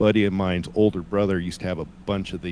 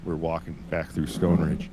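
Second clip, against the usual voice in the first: ordinary talk, nothing marked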